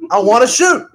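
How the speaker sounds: high, loud whine; whiny shout. Acted. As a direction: whine it out like a bored kid. Sniper whining to partner Bill.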